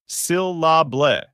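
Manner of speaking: "syllable" broken slowly into three separate parts. Shown as a demonstration. Teacher showing learners how words break into parts, "syllable" split slowly in three. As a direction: say "syllable" slowly in three beats, syl-la-ble. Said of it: "has three syllables".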